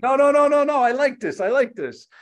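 high, loud, thrilled no no no; excited refusal. Retold or imitated. To his chef, he loudly and happily insists. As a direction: insist with delight that he loves it.

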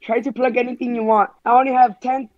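ordinary talk, nothing marked

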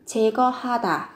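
normal talking voice

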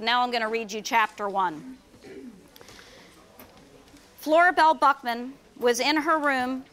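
ordinary delivery

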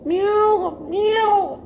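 high drawn-out kitten meows; crying kitten. Acted. Storyteller as the kitten in the river, crying out high. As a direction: wail like a drowning kitten. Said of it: "y el pinche gatito".

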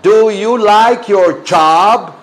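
loud, slow, drawn-out drill; emphatic modelling. Shown as a demonstration. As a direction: hammer out each word slowly for the class. Teacher drills corrected pronunciation.

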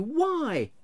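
very high, plaintive question; baffled cry. A narrator's reading. Nephew questioning his uncle's refusal, baffled cry. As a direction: ask again in high dismay. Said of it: "cried Scrooge's nephew".

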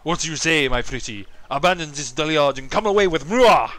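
loud, hammed-up French accent. Suitor woos his lovely little cabbage, hammy French accent. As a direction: flirt like a cartoon Frenchman with a thick accent. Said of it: "just so I could do a French accent".

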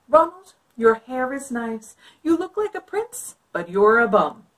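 sassy princess. Acted. Elizabeth tells off prince.

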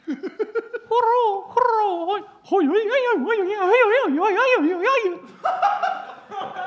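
high, loud yipping howl like a coyote; imitated coyote howl. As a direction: yip and howl like a pack of coyotes at night. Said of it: "that's exactly what coyotes sound like".

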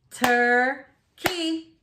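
"Turkey" split as tur, key with a gap; chopped into syllables. Shown as a demonstration. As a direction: chop "Turkey" into two slow beats. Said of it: "chop it out".

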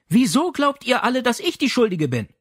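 mother feels wrongly blamed, indignant. Acted. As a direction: protest your innocence, offended. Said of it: "Martha lehnte sich empört zurück".

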